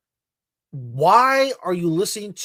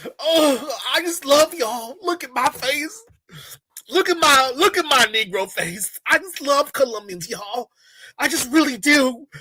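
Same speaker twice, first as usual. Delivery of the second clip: high, loud mocking impression; mock guide; 6 semitones higher, 4 dB louder than usual. Mocking the guide's Colombia video, high and overdone. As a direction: parody his video in a high put-on voice.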